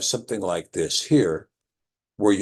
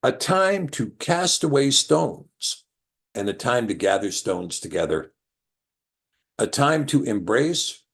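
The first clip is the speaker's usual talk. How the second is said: scripture reading; 3 semitones higher than usual. Acted. Teacher reads Ecclesiastes aloud to class, measured cadence. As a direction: read the verses in a steady, measured cadence.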